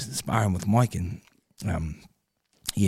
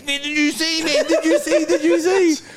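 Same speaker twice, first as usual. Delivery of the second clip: high, excited disbelief; 4 dB louder than usual. Retold or imitated. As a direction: keep repeating it, louder each time. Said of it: "He goes".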